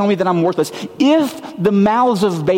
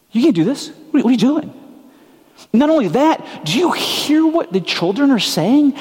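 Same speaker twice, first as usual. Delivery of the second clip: indignant leaders; 7 semitones higher than usual. Acted. Voicing outraged religious leaders.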